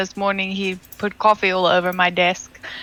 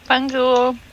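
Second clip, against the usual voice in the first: eating chocolate; 5 semitones higher than usual. Real, not acted. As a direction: mouth full of chocolate. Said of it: "I had a piece of chocolate in my mouth".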